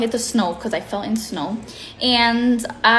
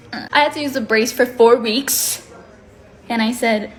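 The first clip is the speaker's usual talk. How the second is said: ordinary talk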